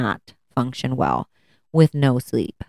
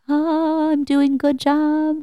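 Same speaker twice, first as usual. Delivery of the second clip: proud relief; 13 semitones higher, much slower than usual. Retold or imitated. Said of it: "and I'm like".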